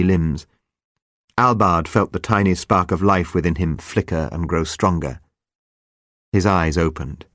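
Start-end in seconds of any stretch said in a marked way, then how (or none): none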